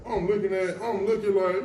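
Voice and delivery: ordinary talk, nothing marked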